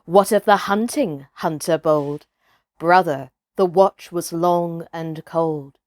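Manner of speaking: ordinary delivery, nothing marked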